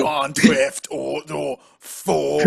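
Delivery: loud, slow, drawn-out title call; half-speed announcer shout; mock title announcement. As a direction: boom it out slowly, stretching the last word.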